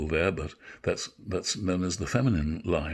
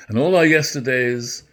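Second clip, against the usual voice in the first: dramatic Shakespeare recitation; 6 semitones higher, 7 dB louder than usual. Acted. Actor performing Macbeth's speech for listeners, full stage voice. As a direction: declaim it to the back of the theatre.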